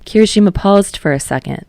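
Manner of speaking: plain reading voice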